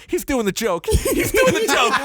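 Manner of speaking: put-on thrilled like a young girl fan; giddy little girl. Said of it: "like a fucking fourteen-year-old little girl. I was so jazzed".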